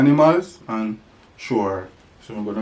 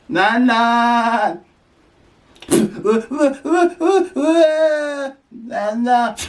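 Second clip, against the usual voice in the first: goat calling; 12 semitones higher, 4 dB louder, much slower than usual. Pretend. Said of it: "That's how the goat goes".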